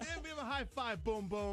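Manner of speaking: high-pitched playful cheer; mock fan cheering. Guest joining the high-five imitation, mock cheering. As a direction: echo the rowdy fan's encouragement.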